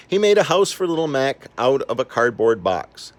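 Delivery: usual speaking voice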